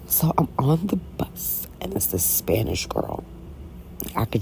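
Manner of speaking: quiet, secretive telling; hushed storytelling. Real, not acted.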